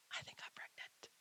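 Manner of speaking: a soft unvoiced whisper of the secret; whispered confession. Real, not acted. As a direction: barely dare to say it aloud. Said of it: "There, I said it".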